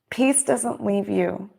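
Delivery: ordinary talk, nothing marked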